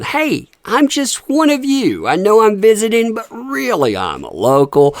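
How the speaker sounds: high, chirpy imitation of a tourist posing as local; a hint of a cheery pretender; mock local. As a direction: lightly play the fake local, eager and breezy. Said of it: "She's trying to sell the people on".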